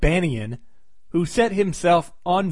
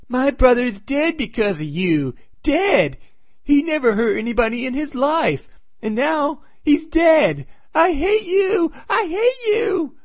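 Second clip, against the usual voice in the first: high, drawn-out wailing accusation; hammed-up grief and rage, very high-pitched; hysterical old lady; 10 semitones higher than usual. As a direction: imitate a distraught old lady, voice climbing higher.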